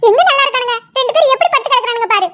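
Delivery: loud, agitated motherly outburst; worried mother snapping back; upset protest. Acted. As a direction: protest loudly that they are not fine. Upset mother disputes they are fine.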